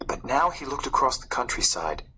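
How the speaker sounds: ordinary delivery, nothing marked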